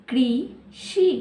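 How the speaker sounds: कृषि drawn out slowly, syllable by syllable; slowed to syllables. Shown as a demonstration. Teacher showing children how to read, slowly syllable by syllable. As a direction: stretch कृषि out so each part is heard. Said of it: "कैसे पढ़ेंगे इसे?".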